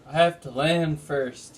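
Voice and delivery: ordinary delivery, nothing marked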